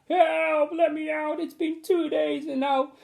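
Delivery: put-on high child's voice pleading; boy crying for help. Trapped boy crying for help to the teacher, high child's voice. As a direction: cry out from the cupboard. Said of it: "cried the boy".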